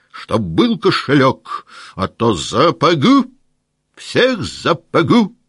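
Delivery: high, loud, slow threatening roar; furious shout. Acted. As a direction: threaten to thrash everyone, full force. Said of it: "вдруг закричал Денисов".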